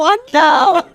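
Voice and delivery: high laughing I know; laughing agreement. Retold or imitated. Answering her kids, laughing and high. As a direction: giggle it out, delighted. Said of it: "I go".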